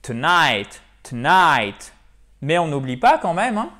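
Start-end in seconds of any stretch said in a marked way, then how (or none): 0.0-1.9 "tonight" with a loud, long NIGHT; stress on second syllable. Shown as a demonstration. Teacher modelling tonight for French learners, stressed on NIGHT. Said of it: "Donc, il faut prononcer".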